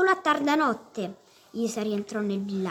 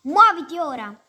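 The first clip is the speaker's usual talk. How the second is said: loud, higher jeering order; taunting shout; 4 semitones higher, 9 dB louder than usual. A narrator's reading. Mesei jeering at the pinned boy, loud taunt. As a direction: bark it down at the boy under you.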